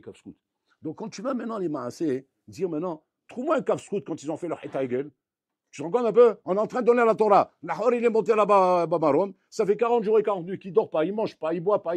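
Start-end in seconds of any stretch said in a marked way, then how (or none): 5.7-12.0 loud, rising sermon fervour; fervent preaching. Real, not acted. Rabbi stirring up his congregation, loud and fervent. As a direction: preach it out with amazement, louder. Preacher building to excited peak.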